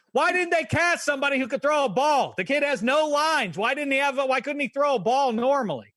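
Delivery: high, loud incredulous complaint; exasperated rant. Real, not acted. Co-host complaining about the actor's throw, exasperated. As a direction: throw up your hands at the casting.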